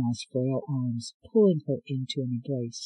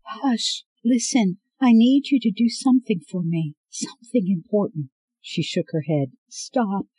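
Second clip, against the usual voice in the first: a hint of a frail old woman, higher and pleading; lightly voiced grieving grandmother; 7 semitones higher, 5 dB louder than usual. Grandmother begging Scarlet to leave, lightly voiced and distressed.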